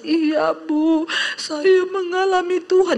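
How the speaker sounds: high, strained mock-crying; imitated tearful voice. Convert on the phone to her pastor, imitated crying. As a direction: retell it as if tearfully overwhelmed. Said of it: "Dia nangis".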